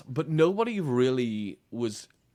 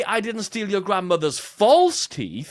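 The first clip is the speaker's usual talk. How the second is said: false punched, louder, slow; louder and slow, weight on false; stress on FALSE; 6 semitones higher, 6 dB louder than usual. Shown as a demonstration. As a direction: lean hard on false as if they were real. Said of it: "a very different crime".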